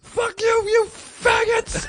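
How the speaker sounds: slow, very high screamed insult; enraged scream. Retold or imitated. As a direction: let it rip at the top of your voice. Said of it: "I got so keyed up that finally I went".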